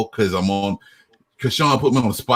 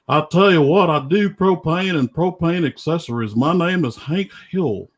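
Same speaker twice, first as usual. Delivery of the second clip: higher-pitched Southern cartoon dad; Hank Hill; 7 semitones higher than usual. Guest shows off his cartoon impression, Hank Hill voice. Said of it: "gonna try to do the Hank Hill impression".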